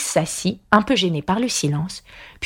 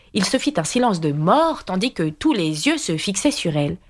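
ordinary delivery

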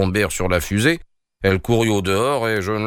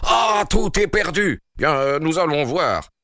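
king's despair; 8 semitones higher than usual. A narrator's reading. King despairs at loss.

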